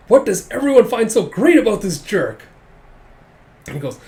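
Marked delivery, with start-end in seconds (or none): 0.1-2.4 loud, high mock fury; comic jealous rant at raised pitch; exasperated outburst. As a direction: demand it in hammed-up frustration. Rival fed up with admiration.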